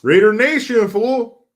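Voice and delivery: loud, high-pitched sports fan holler; hollered fan greeting. Acted. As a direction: call out the chat message like a rowdy fan. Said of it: "Two Phones Jumping says".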